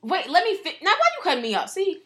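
indignant protest. Real, not acted. Cut off mid-sentence, protests.

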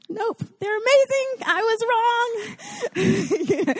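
high, stunned admission; tasting the cookie and admitting defeat, high; grudging awe. Retold or imitated. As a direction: concede it, amazed. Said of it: "And I'm like".

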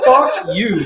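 high, loud, laughing fuck you; laughing mock retort. Telling off the rival boss over text, laughing and loud. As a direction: toss it back through a laugh.

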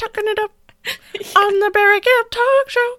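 high, loud falsetto talk. Retold or imitated. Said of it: "And he'd be like".

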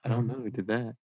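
soft, mock-innocent mother; a touch of coy denial; feigned innocence. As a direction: play dumb a little too sweetly. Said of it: "She's like".

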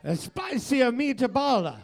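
high, slow, hammed-up Italian accent; mock Italian accent. Shown as a demonstration. Co-host demonstrates the trick phrase, exaggerated Italian accent. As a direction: bounce it out like a cartoon Italian accent. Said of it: "I thought it was Super Mario".

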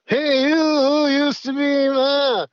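very high, drawn-out caricature; guy from Minnesota. Acted. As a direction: stretch it out in a high impression voice. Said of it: "the guy from Minnesota".

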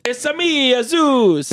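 put-on high, hammy Italian accent; a cartoon Italian plumber voice, Italian accent. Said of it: "having a bad Italian accent".

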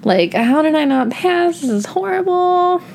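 high-pitched imitation of her own sobbing; a hammed-up, high teary whine; mock sobbing wail. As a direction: wail it like a teen who just failed. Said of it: "I remember just sobbing and being like".

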